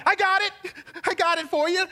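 high, loud with a laugh; excited. Retold or imitated. Preacher reliving finding the right gift, laughing and excited. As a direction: burst out with joy at finding it. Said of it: "I'm like".

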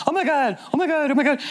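high, excited repeated cry; hammed-up excited chorus of people. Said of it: "people going".

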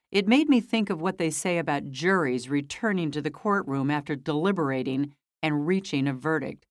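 plain reading voice

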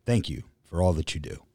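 ordinary delivery

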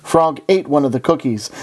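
normal talking voice

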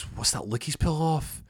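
high puzzled question; baffled. Retold or imitated. Cooper baffled at the strange stance, high. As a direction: pitch it up in confusion.

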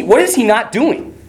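ordinary delivery

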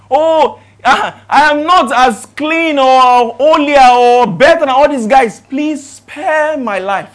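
loud, high, slow pleading; begging. Retold or imitated. Captain begging the prophet for his life, loud imitated pleading. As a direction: plead at full voice, drawing out each phrase. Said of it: "He says, he begged".

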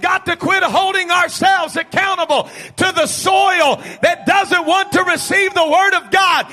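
high, loud, driving sermon shout; impassioned preacher, key words stretched and belted; full-force preaching. Real, not acted. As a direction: preach it out to the whole congregation.

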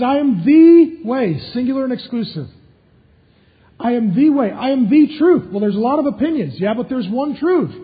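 a preacher at the peak of the sermon, shouting with conviction; full-force preaching. Acted. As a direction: thunder it out to the congregation.

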